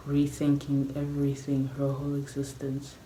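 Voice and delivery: ordinary talk, nothing marked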